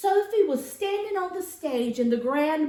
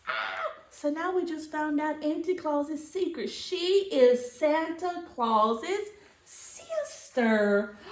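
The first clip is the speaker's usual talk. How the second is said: ordinary delivery, nothing marked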